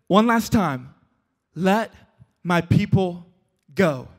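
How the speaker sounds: measured, with a pause between phrases; weighty, spaced-out ultimatum; final warning. Acted. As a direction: deliver it slowly as a last demand, dropping at the end. Moses' final solemn demand.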